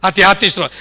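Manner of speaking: ordinary talk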